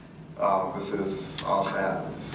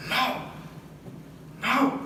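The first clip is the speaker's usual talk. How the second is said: high strained shouts of no; high jinn voice; 14 semitones higher than usual. Retold or imitated. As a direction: voice the possessing jinn refusing. Possessed man refusing, jinn voice.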